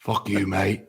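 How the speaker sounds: Jason Statham. Pretend. Co-host mimics his friend's demo, mock British tough guy. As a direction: copy a British hard man's curse. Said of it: "I just gotta talk Jason Statham".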